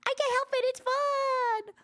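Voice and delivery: very high, loud gushing; cutesy squeal. Shown as a demonstration. Streamer owning up to her imitating habit, high cutesy squeal.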